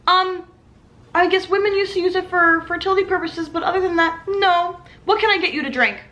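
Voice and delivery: high flustered server voice. Retold or imitated. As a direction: brush it off brightly.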